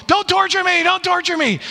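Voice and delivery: high-pitched, mild mock yell. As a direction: imitate a possessed man yelling, without much volume. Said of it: "he's yelling".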